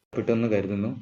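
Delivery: usual speaking voice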